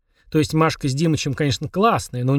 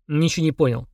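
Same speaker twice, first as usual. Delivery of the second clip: usual speaking voice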